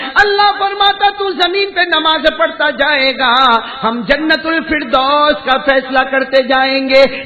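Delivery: loud, high, sustained proclamation; impassioned ringing sermon climax; preaching at full force. Acted. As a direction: declaim the promise, stretching key words.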